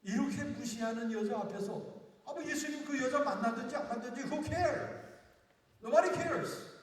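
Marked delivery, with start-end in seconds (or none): 2.2-6.7 high, loud scoffing shrug; mock indifference. Pastor acting out the woman's indifference, high and loud. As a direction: throw it away like nobody cares. Preacher voicing dismissive attitude.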